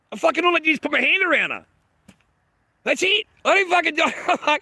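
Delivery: outraged, almost squeaking with disbelief; high indignant disbelief. Real, not acted. Outraged retelling of the kick.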